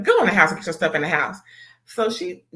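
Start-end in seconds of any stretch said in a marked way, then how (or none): 0.0-1.4 put-on fast, brisk order to the kids; rushed order. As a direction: hurry the kids inside. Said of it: "She's like".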